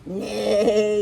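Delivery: loud wavering whinny; imitated neighing; horse neigh. A narrator's reading. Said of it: "But what he thought was".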